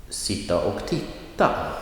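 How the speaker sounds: sitta och titta with stress falling on titta; phrase stress. Shown as a demonstration. Teacher modelling phrase stress for learners. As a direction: model the Swedish phrase, last word stressed. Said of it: "Listen to the examples".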